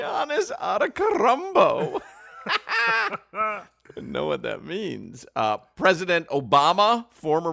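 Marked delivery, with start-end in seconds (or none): 0.0-2.0 Antetokounmpo said "AH-duh-kuh-RUM-bo", high-pitched; imitated mispronunciation. Host mimicking the broadcaster's attempt, high mock mispronunciation. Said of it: "Here was his attempt".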